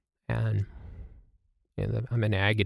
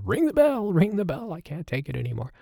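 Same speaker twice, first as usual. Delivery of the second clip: squeaky cartoon voice; 8 semitones higher than usual. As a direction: squeal it like a cartoon character begging. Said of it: "so funny to hear the cartoon voices".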